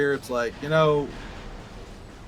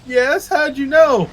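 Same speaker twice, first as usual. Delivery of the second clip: exaggerated reply; 9 semitones higher, 6 dB louder, slower than usual. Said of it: "That was just an exaggerated".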